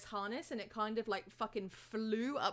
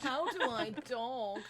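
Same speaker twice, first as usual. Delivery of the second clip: voicing the dog; 4 semitones higher, slower than usual. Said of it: "didn't know how to dog".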